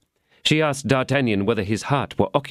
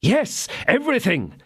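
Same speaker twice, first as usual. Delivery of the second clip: much higher, eager exclamation; exclaiming; 14 semitones higher than usual. Acted. D'Artagnan pledging himself to the lady, eager exclamation. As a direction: blurt it out eagerly. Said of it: "exclaimed D'Artagnan".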